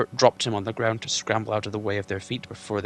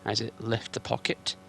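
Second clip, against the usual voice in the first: ordinary delivery, nothing marked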